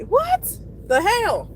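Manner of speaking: slow, drawn-out high what the hell; disbelieving what the hell. Real, not acted. Venting about parking lot rules, slow high disbelief. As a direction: stretch it out in disbelief.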